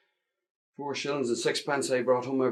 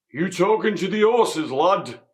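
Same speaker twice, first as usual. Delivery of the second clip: sudden stern call from behind, higher and louder; sharp stern boss; 9 semitones higher, 10 dB louder than usual. Acted. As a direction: catch the lad off guard, sternly. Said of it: "I heard this sharp voice behind me".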